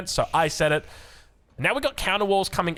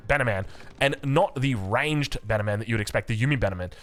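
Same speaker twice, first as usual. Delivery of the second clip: ordinary delivery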